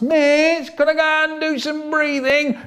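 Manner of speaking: put-on high whining child; whiny pupil begging the teacher to breathe; whiny kid.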